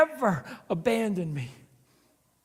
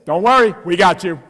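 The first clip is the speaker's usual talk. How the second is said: loud, cheery autoplay promise; Netflix voice; 12 dB louder than usual. Retold or imitated. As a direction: hype it like the app talking. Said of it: "it goes like this".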